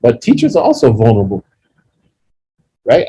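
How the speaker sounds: ordinary talk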